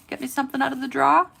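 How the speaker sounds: put-on Southern accent. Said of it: "'cause I would say".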